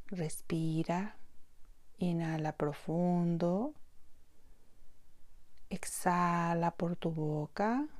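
slow, soft meditation voice; guided meditation. Acted. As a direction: gently guide them into breathing and awareness, with long pauses. (voz pausada y suave de meditación guiada)

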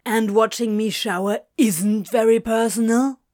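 loud, scornful retort; scathing. A narrator's reading. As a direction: fire the question back scathingly. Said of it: "Crowley exclaimed scathingly".

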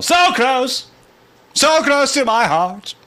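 high, loud rock voice; talking rock. Acted. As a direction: croon it like a rock singer. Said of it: "do voices".

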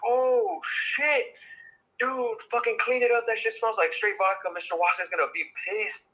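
high, frantic mock panic; high-pitched frantic classmates yelling; panicked classmates. Classmates panicking over vomit.

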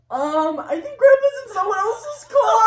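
high, loud mock-alarmed announcement; acted alarm. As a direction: call it out in hammed-up dismay. Alerting family to grandpa's mistake.

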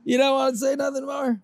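high, shouted mock outrage; mock indignant. Friend voicing the actor, high mock indignant shout. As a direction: play the offended celebrity.

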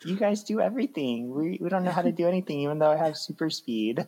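high-pitched put-on sidekick voice; mock useless follower. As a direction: imitate a helpless tagalong handing over all the work. Acted useless followers, mocking.

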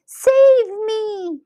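very high, loud, drawn-out plea; frightened cry. A narrator's reading. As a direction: cry to be rescued, stretching it high. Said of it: "she cried as she started going up".

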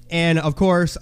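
normal talking voice

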